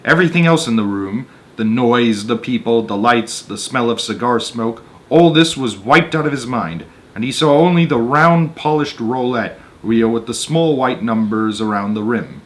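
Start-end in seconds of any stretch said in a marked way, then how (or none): none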